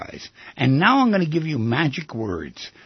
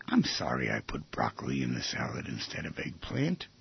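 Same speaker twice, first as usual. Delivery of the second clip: soft apology; 14 semitones lower, 11 dB quieter than usual. Shown as a demonstration. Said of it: "turn around and say".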